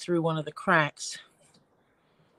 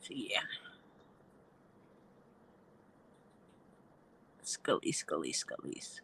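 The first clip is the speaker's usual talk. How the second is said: whispering to herself; 10 semitones lower, 14 dB quieter than usual. Real, not acted. Talking to herself while crafting.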